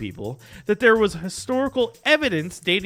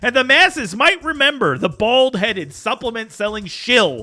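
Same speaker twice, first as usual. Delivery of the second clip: dramatic; 3 semitones higher, 6 dB louder than usual. Pretend. Dramatic doom speech about Rogan.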